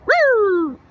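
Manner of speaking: high, loud siren whoop; loud falling mock siren; imitated siren. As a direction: wail "woo" like a police siren.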